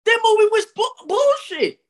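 very high-pitched indignant verdict; outraged dismissal. Real, not acted. Dismissing a movie the friend defends, outraged. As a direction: squeal it out in disbelief.

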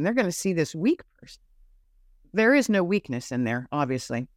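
ordinary talk, nothing marked

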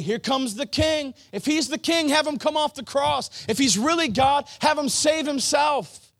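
high, loud taunting shout; jeering shout. Acted. Mockers taunt the king on the cross, jeering shout. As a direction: shout the taunt at full force.

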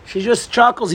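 ordinary talk, nothing marked

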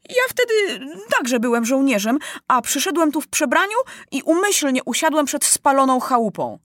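put-on high, earnest boy's voice; stubborn insistence. A narrator's reading. Maciuś explaining his disguise, stubbornly insisting. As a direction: argue your case, pitched high. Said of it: "znów upierał się Maciuś".

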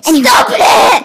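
loud scolding yell; shout. Real, not acted. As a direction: yell at him to stop. Telling brother to stop.

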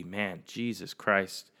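normal talking voice

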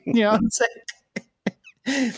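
high excited laugh. Real, not acted. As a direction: burst out laughing yeah. Burst of laughter.

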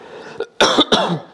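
harsh breathy coughing fit; real cough. Real, not acted. Reader coughs between lines of the story. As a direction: cough it out hard.

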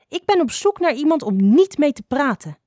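high-pitched, mildly grumpy retort. A narrator's reading. Little adder rebuffs a chatty stranger, grumpy. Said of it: "reageerde een nogal mopperig klein addertje".

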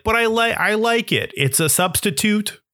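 high, pleading mock protest; high-pitched self-justifying retort; defensive protest. Defends himself to scornful uncle.

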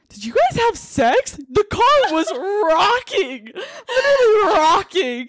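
high, loud incredulous retelling; hammed-up disbelief, drawn out and rising; mock scandalized friend. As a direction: stretch the key word, shouting through laughter. Friend's shocked question, acted out.

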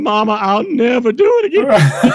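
high, laughing apology; laughing promise. Retold or imitated. Son laughing as he promises his mother, pitched high. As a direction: laugh while promising mama. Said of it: "She started to yell".

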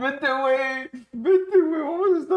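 put-on loud, very high-pitched drunken begging; mock tearful drunk pleading. Drunk friend urging everyone to join in, mock tearful pleading. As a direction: plead in a hammed-up, high, wailing voice. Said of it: "No, pero así llorando no".

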